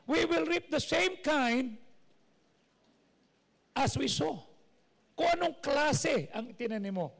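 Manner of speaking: impassioned sermon voice; preaching. Acted. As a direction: drive each point home from the pulpit. Preaching to congregation.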